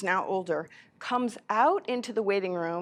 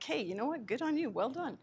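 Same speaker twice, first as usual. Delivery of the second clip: high, sarcastic well done; mock congratulate him, lightly; sarcastic pat on the back; ironic praise; 6 semitones higher, 4 dB quieter than usual. Sarcastic reply to being slapped.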